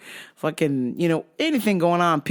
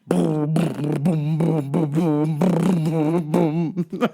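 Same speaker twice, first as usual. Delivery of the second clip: imitated bass drop; much slower than usual. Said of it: "electronic song".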